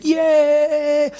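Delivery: high, drawn-out crowd cheer. Retold or imitated. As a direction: imitate a crowd's long "yay". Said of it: "People were cheering".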